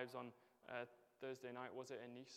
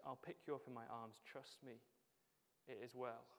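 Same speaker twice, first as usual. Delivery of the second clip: softer, gentle promise of comfort; tender reassurance; 2 semitones lower, 7 dB quieter than usual. Acted. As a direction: quietly assure them it's all right. Jesus comforting, picking us up.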